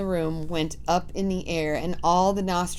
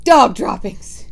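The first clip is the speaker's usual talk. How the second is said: loud yelled alarm; 3 semitones higher, 11 dB louder than usual. Acted. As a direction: yell out the discovery. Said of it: "she yelled".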